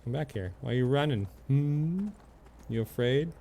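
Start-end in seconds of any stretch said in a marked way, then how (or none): none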